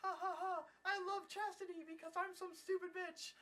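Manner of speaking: high-pitched fake giggly girl voice; squeaky, hammed-up girl impression; mocked silly girl. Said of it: "the one girl's like".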